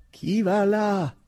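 loud, high, slow challenge; sentry shouting a final challenge; third warning shout. Acted. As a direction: drag out the last warning call. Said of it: "gridò la terza volta la sentinella".

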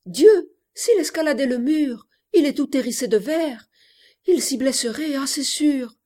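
high, alarmed outburst, loud at the start. Acted. Girl picturing Ernest cut on the glass-topped wall, alarmed. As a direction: cry out at the danger, pitch held up. Imagines lover hurt climbing wall.

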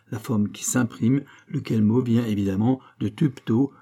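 usual speaking voice

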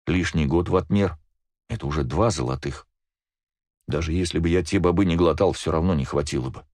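usual speaking voice

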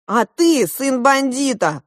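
high, loud, slow retort; snapping back. A narrator's reading. Younger prince answering Klop's insults, snapping back. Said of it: "огрызнулся младший принц".

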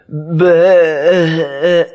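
long, wavering bleat; bleating like a lamb. A narrator's reading. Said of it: "bêlant comme un agneau". (bêlant comme un agneau)

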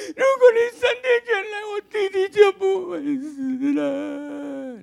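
crying, high-pitched shouted lament, drawn out; wailing grieving sister. Retold or imitated. Mary crying out to Jesus at the tomb, high wailing sobs. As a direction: wail it like a sister whose brother died. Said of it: "你不会哭得像我这么惨吧".

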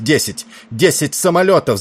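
high, loud alarm cry; excited shout rising sharply in pitch. A narrator's reading. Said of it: "закричал он".

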